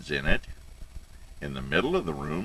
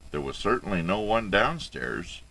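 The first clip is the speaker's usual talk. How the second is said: ordinary delivery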